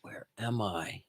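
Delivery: breathy hushed whisper, eyes closed; hushed mystic whisper. Pretend. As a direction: whisper it as if in a trance. Mock meditative Randonautica prompt.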